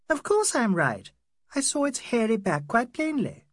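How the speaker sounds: put-on very high young woman's voice; insistent Adela. Adela defending what she saw in the dark, insistent.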